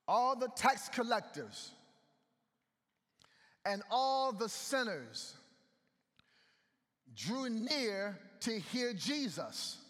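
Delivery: every word drawn out and weighed; slow it down so the church hears it; slow emphatic reading. Shown as a demonstration. Preacher slowing to stress the verse.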